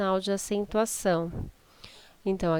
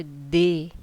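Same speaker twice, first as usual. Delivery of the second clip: D said very firmly, louder, as a strong syllable; stressed DÊ; 3 semitones lower, 5 dB louder than usual. Shown as a demonstration. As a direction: hit D hard as a stressed syllable. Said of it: "tá falando bem forte".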